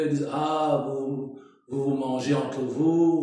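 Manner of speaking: usual speaking voice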